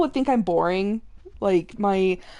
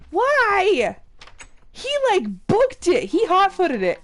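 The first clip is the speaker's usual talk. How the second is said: higher, louder startled exclaim; startled outcry; 8 semitones higher, 5 dB louder than usual. Real, not acted. Gamer exclaiming as her pig runs away, startled. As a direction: cry out as the pig bolts.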